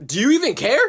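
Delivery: usual speaking voice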